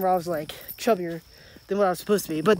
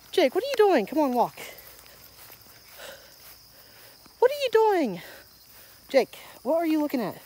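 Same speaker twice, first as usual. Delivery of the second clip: calling the dog; 7 semitones higher than usual. Real, not acted.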